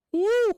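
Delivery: sudden high, loud whoop of glee; real whooping cheer; excited woo. Real, not acted. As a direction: let out a thrilled cheer. Cheering a big drop target.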